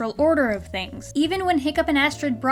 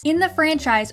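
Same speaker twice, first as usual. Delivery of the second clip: ordinary talk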